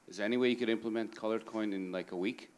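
ordinary talk

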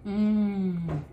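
speaking around a gummy; talking while chewing. Real, not acted. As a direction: mouth partly full of candy. Seen 0: Woman's cheek bulges while talking after eating yellow gummy; hand covers mouth later.

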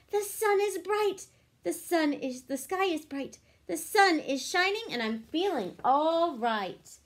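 bright, lively storybook reading; reading to children. A narrator's reading. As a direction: read it like a picture book for kids. Reading picture book aloud.

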